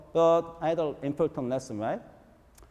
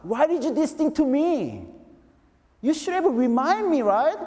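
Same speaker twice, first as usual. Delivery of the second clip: driving back from the missed meeting, crying out to God; retold yelling; 10 semitones higher than usual. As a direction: hurl the question at God, pitched high. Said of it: "yelling to God".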